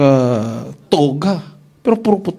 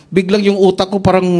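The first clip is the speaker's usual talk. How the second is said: usual speaking voice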